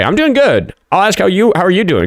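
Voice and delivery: high, bright-pitched reply; perky greeting to a viewer; cheery. Real, not acted. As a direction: chirp it back way up high.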